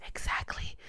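hushed, voiceless emphatic whisper; whispered agreement. Retold or imitated. Client agreeing with her therapist, whispered.

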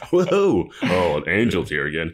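comic Kramer entrance with a loud whoa; Kramer-style Angel. Pretend. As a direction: burst in like a sitcom klutz. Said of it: "Seinfeld-esque comedy where he's Kramer".

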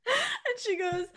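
high, laugh-strained words. Real, not acted. Retelling Mom's reaction to her cohost, still laughing. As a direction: push the words through a laugh.

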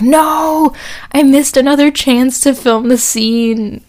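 high, slow dismayed wail; dismayed cry. Retold or imitated. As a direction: cry out in mock despair. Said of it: "So I was like".

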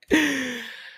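high squeaky burst of laughter; short high laugh at oneself. Real, not acted. Podcaster laughing at her own catchphrase, high laugh.

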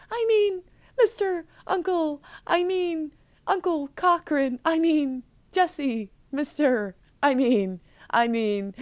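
high voice sinking step by step to normal; flustered fake voice. Disguised girl keeps correcting her uncle's name, flustered fake voice. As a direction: fumble the names, letting the put-on pitch collapse. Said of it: "she finally breaks down".

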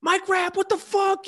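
high, excited fan call. Retold or imitated. Fan on the street spotting Mike Rapp, high excited call. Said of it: "they're like".

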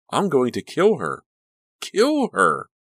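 rising to a frenzied high scream; building rage. Acted. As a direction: let the fury boil over. Rage building to kill cry.

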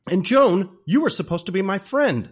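high, loud betrayed-wife protest; mock outrage. Wife catches Jack and Joan kissing, mock outrage.

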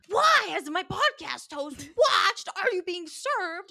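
high, slow whiny Neville; mock Neville. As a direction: squeak it out, indignant and drawn out. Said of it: "Neville's like".

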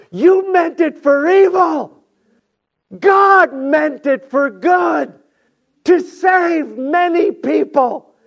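high, loud sermon shout; full-force preaching. Acted. Preacher voicing Joseph's revelation to his brothers, full-force preaching.

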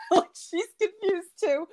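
high-pitched, laughing through the words. Real, not acted. Laughing over niece's birthday mix-up.

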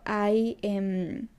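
ordinary delivery

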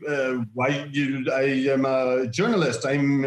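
lightly imitated French accent, halting. Exposed fake reporter claiming to be a journalist, in French accent. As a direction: stammer a protest in a French accent. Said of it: "a very distinct French accent".

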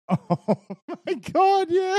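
very high, loud laughing exclamation. Real, not acted. Friend recalls a gross scene, squealing with laughter.

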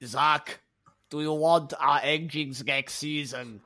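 slow, put-on Austrian accent; deliberate bad Austrian boss impression; Toto, Austrian accent. Team boss leaning on Zach, slow Austrian accent. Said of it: "It's Austrian, isn't he?".

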